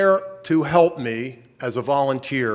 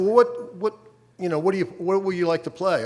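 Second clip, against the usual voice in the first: puzzled; 7 semitones higher than usual. Retold or imitated. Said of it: "I said".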